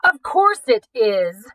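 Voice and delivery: slow, very loud shout into the phone; exasperated shout. Acted. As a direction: bellow it, fed up. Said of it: "Gregory Wainwright shouted".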